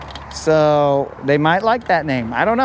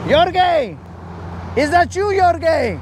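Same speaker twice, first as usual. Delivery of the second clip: pitched-up mock shout of a passing driver; high mock yell from a truck; 10 semitones higher than usual. A streamer imitating a driver yelling from a Penske truck, high mock shout. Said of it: "he was like".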